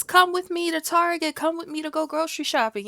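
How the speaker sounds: bubbly, high influencer imitation. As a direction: chirp it like a TikTok influencer. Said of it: "TikTok influencers, they be going places".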